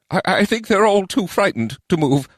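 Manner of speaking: high, stammering goblin squeak; nervous goblin. A narrator's reading. Goblin reporting the frightened townsfolk, nervous. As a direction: squeak it out uneasily as a little goblin.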